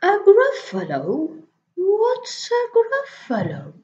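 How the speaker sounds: high, loud questioning snake; puzzled snake. Acted. Snake baffled by the mouse, high and loud. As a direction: rise up in surprise.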